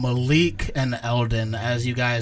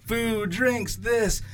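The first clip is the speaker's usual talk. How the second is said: higher, lightly voiced hawker; vendor calling out; 10 semitones higher, much slower than usual. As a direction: call out wares across a crowd.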